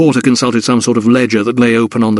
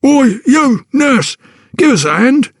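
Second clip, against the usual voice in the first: gruff porter; 13 semitones higher, slower than usual. Acted. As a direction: bark it like a rough porter. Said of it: "commanded a gruff voice nearby".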